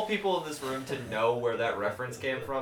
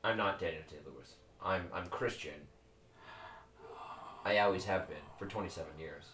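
much lower, quieter deadpan; 7 semitones lower, 9 dB quieter than usual. Pretend. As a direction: say it low and flat-faced. Pretending to be someone else.

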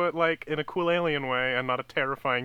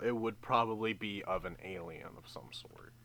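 whispering; 4 semitones lower, 10 dB quieter than usual. Acted. Confessing soul emoji.

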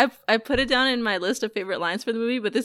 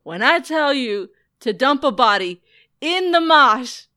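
hammed-up Costello yelling, growing louder; Costello impression; 7 semitones higher, 10 dB louder than usual. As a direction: ham up the mob boss telling him off. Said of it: "when Costello's like".

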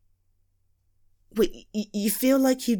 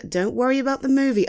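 usual speaking voice

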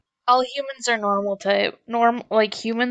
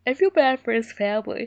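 said through a yawn; 2 semitones higher than usual. Real, not acted. Cohost yawning about Dozel, yawn through it. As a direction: yawn out the sympathy. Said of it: "I say while yawning about Dozel".

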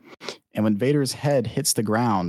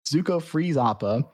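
ordinary delivery

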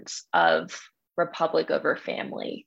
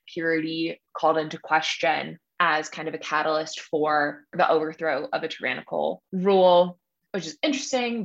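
ordinary delivery

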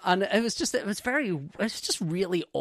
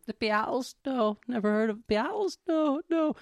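incredulous; 7 semitones higher than usual. Retold or imitated. Anoraks incredulous in sketch.

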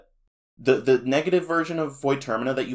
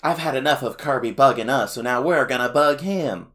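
higher, louder whiny villain voice; Escargoon voice; 5 semitones higher, 5 dB louder than usual. Retold or imitated. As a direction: sneer it like a cartoon villain. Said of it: "Escargoon goes".

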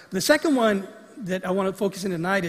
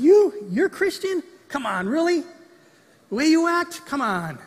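much higher and louder, scoffing incredulity; mock disbelief; 7 semitones higher, 5 dB louder than usual. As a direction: laugh off the claim in mocking disbelief. Mocking someone's claim to faith.

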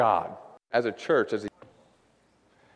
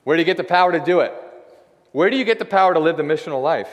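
loud, fast, pressing question to the congregation; louder preaching push; rousing preacher's call; 5 semitones higher, 6 dB louder, faster than usual. Real, not acted. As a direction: fire up the room with it.

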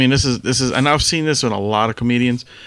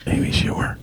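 whisper; 6 dB quieter than usual. Real, not acted. Deadpan answer aside.

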